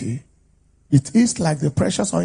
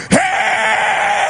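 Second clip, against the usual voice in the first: long, high shouted Hey; preacher's war cry; 7 dB louder than usual. Acted. Preacher confronting a devil, high drawn-out shout. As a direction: holler it high and sustained.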